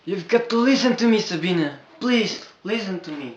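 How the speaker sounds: desperate pleading. Acted. George urges Sabina to listen, loud urgent pleading. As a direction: plead with everything you have.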